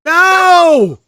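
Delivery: sudden high, loud "No!" of embarrassed denial; loud protest. Real, not acted. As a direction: cut off the joke with a loud, high no. Protesting a crude misreading.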